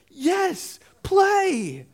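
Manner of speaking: put-on a small child happily agreeing; toddler voice. Toddler answering his dad about praying, high eager childlike voice. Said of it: "He's like".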